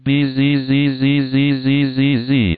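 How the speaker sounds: slow wheezing sing-song through set teeth; wailing wind. Acted. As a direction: wail like a winter wind through clenched teeth. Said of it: "imitate the wailing and wheezing sing-song of the wind".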